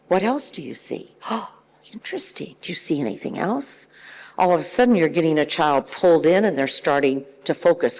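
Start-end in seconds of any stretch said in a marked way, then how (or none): 0.1-3.9 soft, intrigued prompting; coaxing questioner. Shown as a demonstration. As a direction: draw a child in with hushed interest. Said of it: "if you repeat that question".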